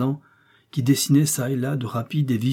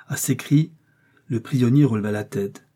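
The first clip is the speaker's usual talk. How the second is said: plain reading voice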